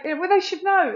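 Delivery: huffy "they should know"; indignant retort. Retold or imitated. Voicing a resentful assumption.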